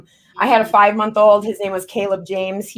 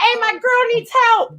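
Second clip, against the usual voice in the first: very high, slower call; mock call for help; 12 semitones higher than usual. Rapper mimicking her friend fleeing the fight, mock calling. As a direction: imitate a frantic cry.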